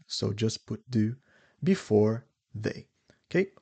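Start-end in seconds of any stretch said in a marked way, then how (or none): none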